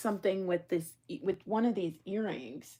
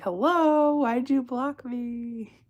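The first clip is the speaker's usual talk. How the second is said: mock friend at the door; 6 semitones higher, 5 dB louder, much slower than usual. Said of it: "come knock on my door like".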